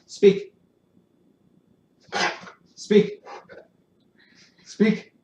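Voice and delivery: loud, firm call for the dog to bark; loud command. Real, not acted.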